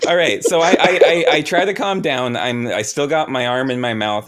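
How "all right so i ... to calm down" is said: laughing. Real, not acted. Player laughing after outburst.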